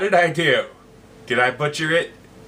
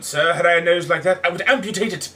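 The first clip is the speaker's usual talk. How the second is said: loud, higher jab of an insult; aggressive tone; 4 semitones higher, 4 dB louder than usual. Acted. Cyrano mocks the heckler aggressively, loud and higher. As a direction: snap it out with aggression. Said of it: "In an aggressive tone".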